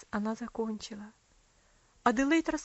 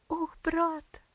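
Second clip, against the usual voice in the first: exclaiming; 4 semitones higher, 4 dB louder than usual. A narrator's reading. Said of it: "воскликнула Аделаид".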